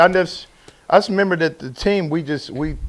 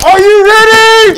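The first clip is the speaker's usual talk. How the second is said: high, slow, loud hype call; camp counselor cheer; 12 dB louder, slower than usual. Acted. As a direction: fire up the crowd. Hype man intro shout.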